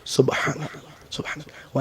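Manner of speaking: barely audible, voiceless murmur; mumbled rote dhikr. Retold or imitated. Preacher mimicking hurried worshippers' dhikr, whispered rote. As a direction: mimic half-swallowed whispered praises. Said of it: "অনেকে সুবহান".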